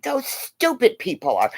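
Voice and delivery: ordinary delivery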